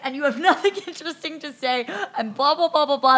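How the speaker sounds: loud, high mock rant; mock yelling. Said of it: "[name] is still yelling".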